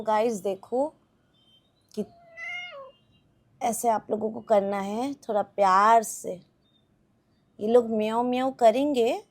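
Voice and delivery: ordinary delivery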